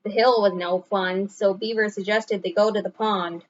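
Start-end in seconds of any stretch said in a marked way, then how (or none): none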